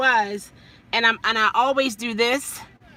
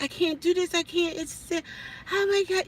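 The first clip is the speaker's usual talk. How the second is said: hysterical crying; 6 semitones higher, 4 dB quieter than usual. Retold or imitated. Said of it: "I would just be hysterical".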